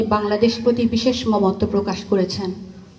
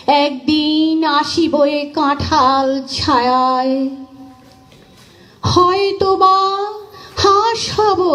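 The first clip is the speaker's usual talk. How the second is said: slow recitation with long drawn-out vowels; poem recitation; 3 semitones higher, much slower than usual. Acted. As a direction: recite it slowly, lifting and sustaining each phrase. (ধীর লয়ে টেনে টেনে কবিতা আবৃত্তি)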